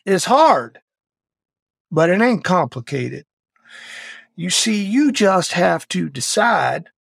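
imitating the SEAL instructor's voice; gruff old instructor. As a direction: bark it like a wounded old Vietnam vet instructor. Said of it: "And he talked like this".

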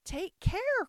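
high, loud warning; crying out. A narrator's reading. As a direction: call out in alarm. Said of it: "cried Alice".

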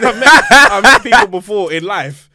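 laughing through the words, loud and high; can't hold the laugh in while telling it; real laughter breaking into the opening of a story. Real, not acted.

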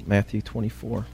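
usual speaking voice

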